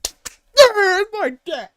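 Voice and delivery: extremely high mock squeal, stretched out; hammed-up proud son, loud and very slow; son cheering on dad. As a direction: shriek it as the adoring son. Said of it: "Every time his dad throws in a zinger".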